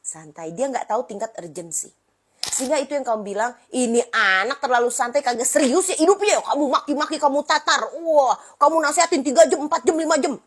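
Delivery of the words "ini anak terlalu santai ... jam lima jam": higher, louder parental rant; raised mock lecture tone; exasperated complaining. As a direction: vent about a lazy kid. Parent complaining about unserious child.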